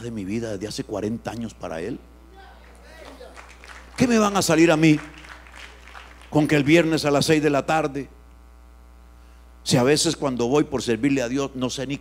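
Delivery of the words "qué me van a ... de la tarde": loud, challenging pulpit cry; indignant preaching. Acted. Preacher rejecting Sabbath rules, loud and indignant. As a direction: throw the question at them.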